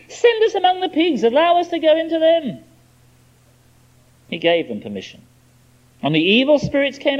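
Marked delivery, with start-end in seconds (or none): 0.1-2.6 begging demons. Acted. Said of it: "The demons begged Jesus".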